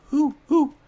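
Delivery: high owl hooting. Retold or imitated. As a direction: hoot like an owl. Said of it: "They just say who".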